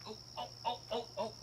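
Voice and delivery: hushed string of high ohs; soft cooing. Real, not acted. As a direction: coo softly, as if off to the side. Still coaxing the little one.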